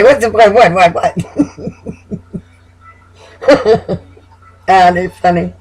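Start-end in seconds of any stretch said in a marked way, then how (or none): 0.0-1.2 a higher, laughing, baffled "what, what"; mock baffled Aries. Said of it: "I know they're gonna say". 3.4-4.0 a short, high burst of laughter; high amused laugh. Real, not acted. Laughs at imitated Aries.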